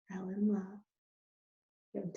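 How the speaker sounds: drawn out slowly, soft and tender; tender storybook hush. A narrator's reading. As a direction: let the words melt, very slowly. Narrator savors the moment.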